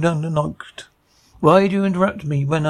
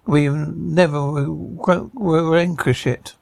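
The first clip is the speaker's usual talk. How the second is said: ordinary delivery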